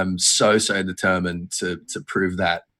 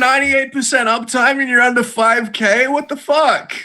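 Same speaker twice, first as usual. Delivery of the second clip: shrieky high put-on mom rant; outraged mom; 4 dB louder than usual. Mom disowning her son over his score, mock outrage. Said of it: "That's basically mom".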